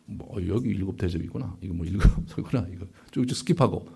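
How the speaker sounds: low, soft, throwaway self-imitation; offhand skimming. Preacher imitating his old careless Bible reading, offhand skimming. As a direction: toss it off like skimming a page.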